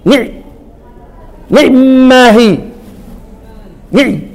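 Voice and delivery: a quick ني with the kasra barely voiced; snatched kasra. Shown as a demonstration. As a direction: clip the kasra in ني short. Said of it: "اختلس بقى الكسرة". (ني بكسرة مختلسة قصيرة)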